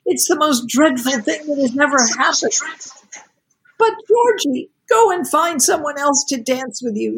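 put-on a young woman close to crying, pushing him away; almost crying. A narrator's reading. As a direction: hold back tears while refusing him. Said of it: "almost crying".

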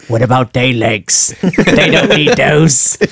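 slow, gruff-spoken orc line. Retold or imitated. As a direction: drawl it out like a hungry orc. Orc voiced from film quote.

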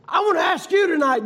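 preaching. Acted. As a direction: call it out over the church. Preaching question to congregation.

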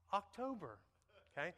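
ordinary talk

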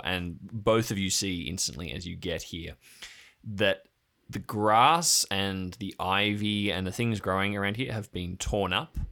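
usual speaking voice

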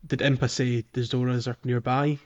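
ordinary delivery

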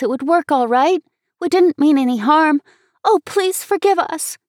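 high, loud, urgent apology; pleading, near tears. A narrator's reading. Girl begging Dick's forgiveness, pleading and ready to cry. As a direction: beg forgiveness, pitch climbing at the end. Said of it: "I was just ready to cry".

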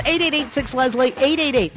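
ordinary delivery

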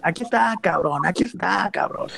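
defiant shout. Retold or imitated. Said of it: "le empieza a gritar así".

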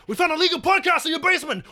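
cop shouting. Acted. Police raid.

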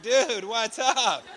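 high, slow, laughing dude; excited laughing greeting. Retold or imitated. Prisoner greets a fellow American, laughing and excited. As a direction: stretch it out, grinning at the surprise.

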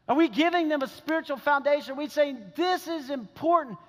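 high, driving pulpit appeal; impassioned preaching. Acted. Preacher challenging parents about their children's faith, impassioned. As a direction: press the challenge on the congregation.

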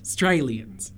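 Australians said as "Straylians"; learner's clipped attempt at Australians, starting on "str"; dropped first syllable. Shown as a demonstration. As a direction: skip the opening vowel of Australians. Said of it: "You, like, don't say half the letters".

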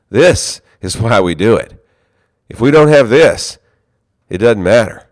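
a retold colleague's earnest outburst; passionate retold plea. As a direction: plead the point with fire. Pastor retelling passionate coworker.

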